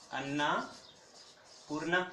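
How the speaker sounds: "Annapurna" stretched slowly, syllable by syllable; teacher drawing out "Annapurna" so students catch it; slow spelled-out name. Shown as a demonstration.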